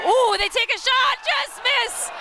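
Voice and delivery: high, loud burst of excitement; excited play-by-play. Real, not acted. Commentator calling a shot that just misses, excited. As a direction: voice leaps up as the shot flies. Commentator calls a near miss.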